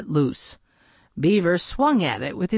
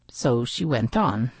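ordinary delivery

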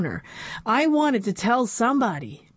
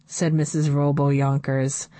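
ordinary delivery, nothing marked

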